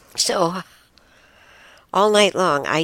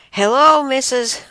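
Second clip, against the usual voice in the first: much higher and louder hello; loud greeting; 12 semitones higher, 9 dB louder than usual. Retold or imitated. Visitors call loudly to the deaf roommate, high loud greeting. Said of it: "Only they said it very loudly".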